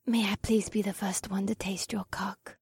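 seductive whisper. Acted. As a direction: ask it in a breathy whisper. Akira seduces Cole.

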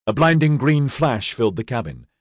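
ordinary delivery, nothing marked

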